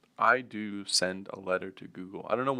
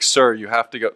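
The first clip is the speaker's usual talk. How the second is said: louder, firmer mock clerk; exasperated clerk; 3 semitones higher, 12 dB louder than usual. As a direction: insist politely but louder. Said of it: "And he's like".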